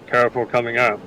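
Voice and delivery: slightly lifted voice of a cautious fighter; calling out a warning. Acted. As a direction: call out lightly to companions behind you. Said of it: "kinda calling out".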